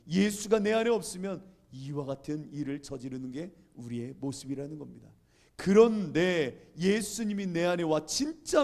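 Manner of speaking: forceful sermon cadence with loud peaks; emphatic preaching. Real, not acted. Preacher drawing the moral from his story, emphatic. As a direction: drive the lesson home to a congregation.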